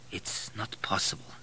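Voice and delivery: hushed, barely voiced murmur; softly. A narrator's reading. Abel at his first sight of the old woman, softly. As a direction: say it very softly, almost under the breath. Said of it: "said Abel softly in English".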